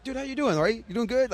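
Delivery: bright, high, friendly call to a passing skater; cheerily hailing a fellow racer; upbeat greeting. Retold or imitated. Greeting each skater he passes, high and upbeat. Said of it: "I'm like".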